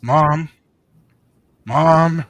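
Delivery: loud, drawn-out calls of Mom; nagging child calling his mother; kid whining for mom. Retold or imitated.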